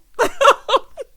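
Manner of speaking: loud burst of high laughter. Real, not acted. Vlogger laughing at Mila's look, loud laugh. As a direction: crack up hard.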